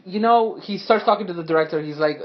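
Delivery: usual speaking voice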